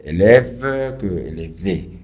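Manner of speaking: F and V each sounded out slowly and firmly; careful, drawn-out "F" against "V"; contrasted consonants. Shown as a demonstration. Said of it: "no es lo mismo pronunciar".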